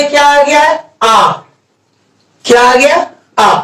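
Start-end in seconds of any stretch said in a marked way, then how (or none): none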